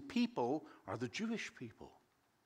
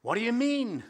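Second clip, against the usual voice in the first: higher and louder, a baffled exclamation; exclaiming in surprise; 7 semitones higher, 6 dB louder than usual. Acted. Preacher voicing Nicodemus baffled by Jesus, exclaiming in surprise. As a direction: blurt out the question in disbelief. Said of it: "exclaimed Nicodemus".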